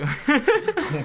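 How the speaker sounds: high burst of laughter; cracking up hard; loud laugh. Real, not acted. Big laugh at sugar mommy joke.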